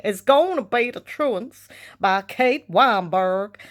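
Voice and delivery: slow, exaggerated American drawl; put-on American voice, stretched out slowly; American accent. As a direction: ham up an American accent, drawing out every word. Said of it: "I put on an American accent".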